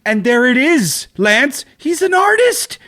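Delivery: high, drawn-out theatrical reveal; soaring, slow declaration; mock-grand proclamation. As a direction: announce it with hammed-up grandeur. Mock-dramatic reveal of the artist.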